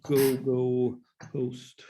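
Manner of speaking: ordinary delivery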